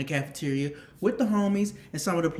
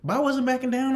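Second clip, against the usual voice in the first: worked-up telling; 8 semitones higher, 6 dB louder than usual. Real, not acted. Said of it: "I was scared as a motherfucker".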